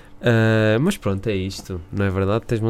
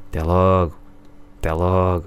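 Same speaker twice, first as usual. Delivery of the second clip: family phone goodbye; 5 semitones lower than usual. Retold or imitated. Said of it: "como a minha família faz".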